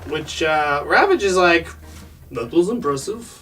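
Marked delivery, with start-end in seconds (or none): 2.3-3.4 mock Russian accent, understated. Ravage reacts to the missile attack, Russian accent. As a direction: comment in a put-on Russian accent. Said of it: "he's said in a Russian accent".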